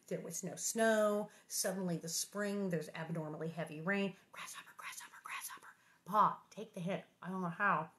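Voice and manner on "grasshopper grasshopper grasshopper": hushed unvoiced chant; spooky whisper. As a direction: whisper it like an omen. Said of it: "spooky whispered chant".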